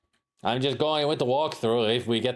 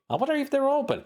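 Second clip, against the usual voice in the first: much higher put-on voice; goofy inner voice; faster than usual. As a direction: squeak the thought out. Voicing Larry's thought.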